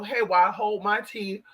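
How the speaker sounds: ordinary talk, nothing marked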